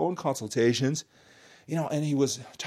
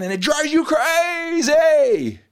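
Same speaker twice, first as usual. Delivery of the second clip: exasperated shout; 11 semitones higher, 6 dB louder, much slower than usual. Real, not acted. Exasperated point about rule books.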